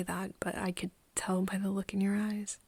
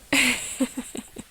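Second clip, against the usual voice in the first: higher, louder burst of laughter; tearful laugh; 5 semitones higher, 5 dB louder than usual. Acted. Apologizing for weird mixed feelings, laughing and crying. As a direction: laugh while close to tears. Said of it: "laughing and crying".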